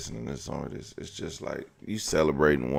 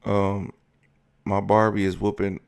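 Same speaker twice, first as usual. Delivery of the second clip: lightly voiced little child; 3 semitones higher, 5 dB louder than usual. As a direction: imitate a little kid chatting, pitched up. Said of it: "she was like".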